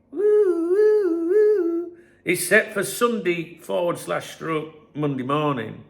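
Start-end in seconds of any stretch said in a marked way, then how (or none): 0.1-2.0 high sung siren wail; mock siren woo. Mock alarm siren over headline.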